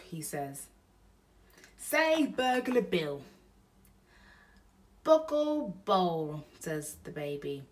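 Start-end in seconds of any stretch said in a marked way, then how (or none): 1.8-3.2 burglar voice. Acted. Bill teaches baby to talk. 5.0-6.4 put-on slow, mangled toddler babble; baby voice. Baby tries to say name.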